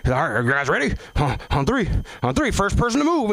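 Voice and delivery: high, building countdown; mock host hyping a count; emcee countdown. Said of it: "the son of a bitch is like".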